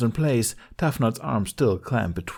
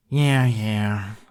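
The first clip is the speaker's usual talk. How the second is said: muttered; 4 dB louder than usual. Acted. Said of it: "Hiccup muttered".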